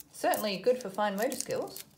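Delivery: ordinary talk, nothing marked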